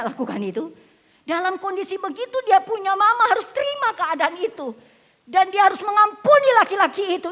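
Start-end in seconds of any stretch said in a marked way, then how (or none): 1.3-7.3 high, loud preacher's cry; impassioned sermon delivery; full-force preaching. Acted. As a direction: preach it out to the congregation.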